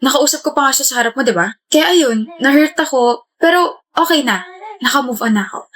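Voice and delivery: normal talking voice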